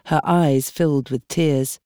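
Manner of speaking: usual speaking voice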